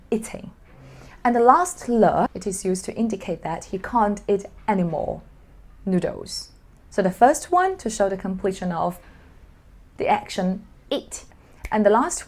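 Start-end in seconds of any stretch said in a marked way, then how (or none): none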